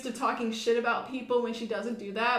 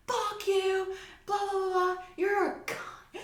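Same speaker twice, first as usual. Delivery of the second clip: imitating a coworker cussing, higher pitched; mock cussing out the boss; 8 semitones higher than usual. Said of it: "starts cussing out our G M".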